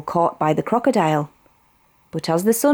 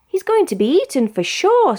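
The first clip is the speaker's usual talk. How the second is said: iguana voice; 10 semitones higher than usual. A narrator's reading. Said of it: "said an iguana".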